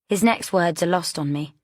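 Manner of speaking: ordinary delivery, nothing marked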